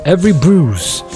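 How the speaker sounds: usual speaking voice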